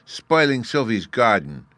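put-on a defiant little boy; sulky child. A narrator's reading. Fairy boy answering curtly while wrecking the garden, defiant. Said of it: "was all the answer".

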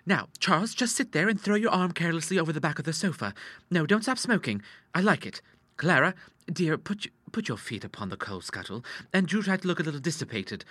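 high, lively stage-managing; bossy, playful daughter. A narrator's reading. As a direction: play the daughter arranging a scene. Voices Ida staging the scene.

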